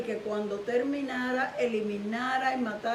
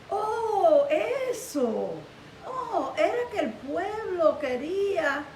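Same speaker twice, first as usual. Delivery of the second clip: higher, drawn-out "oh", lightly acted; mock flustered excuse; 6 semitones higher, much slower than usual. Saúl excuses himself to Samuel, mock flustered excuse. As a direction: play a king caught out, stalling.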